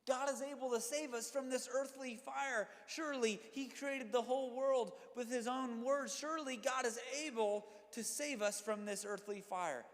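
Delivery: builds to a loud, high declaration; rising proclamation. Retold or imitated. Preacher voicing Shadrach, Meshach and Abednego, proclaiming loudly. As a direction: proclaim it with growing conviction. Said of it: "they say".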